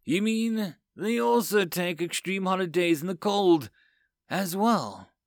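a groaning machine overlord, mildly appalled; digital groan. A narrator's reading. Overseer questions reports of extreme holidays, groaning. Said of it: "The Overseer groaned digitally".

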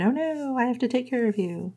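high and soft, hurried and fussy; mock fussing Lucas. Lucas fussing over Rosie in the rain, high and soft.

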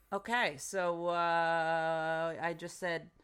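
normal talking voice

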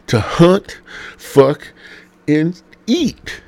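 high, loud, drawn-out pulpit proclamation; preached. Acted. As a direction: boom each word out like gospel.

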